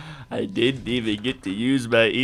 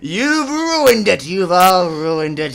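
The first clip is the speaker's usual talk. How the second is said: villain tantrum; 5 semitones higher, 4 dB louder, slower than usual. Acted. Villain furious at interruption.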